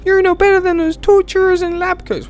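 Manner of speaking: very high falsetto reproach; high-pitched character falsetto; girl visitor. Acted. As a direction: scold in a squeaky put-on girl voice. Voicing the female visitor.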